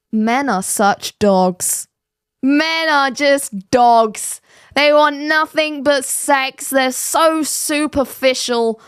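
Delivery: slow, drawn-out mock lament; mock outrage. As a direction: declaim it with exaggerated, sarcastic grievance. Mocks women's complaint about men.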